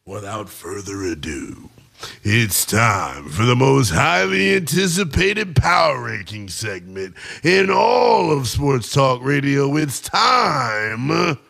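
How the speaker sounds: slow, drawn-out showman's build-up; hammed-up announcer intro. Host introducing his Super Six Pack segment, grand announcer voice. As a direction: start low, stretch out the big words, then drop for the title.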